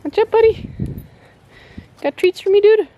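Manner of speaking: high, sing-song greeting to a pet. Real, not acted. Mom greets the pet Ralphie in a high pet voice. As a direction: coo up high to the animal.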